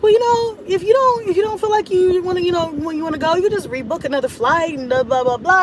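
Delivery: high, lightly voiced mom reassuring; mom's voice. Mom on the phone calming her daughter. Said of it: "She's like".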